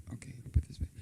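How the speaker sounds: quick whisper to herself; whispering an aside while fiddling with the mic. Real, not acted. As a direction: murmur it off-mic without voice. Aside while fixing the microphone.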